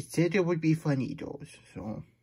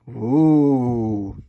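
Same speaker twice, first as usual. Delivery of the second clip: drawn-out, loud mock ooh; a big hammed-up ooh of wonder; 10 dB louder than usual. Reader reacting to a page of words, a loud drawn-out ooh.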